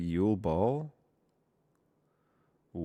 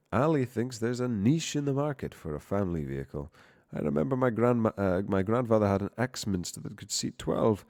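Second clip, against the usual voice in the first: excited reading; 4 semitones higher, 7 dB louder than usual. Acted. Voicing Bartimus with excitement.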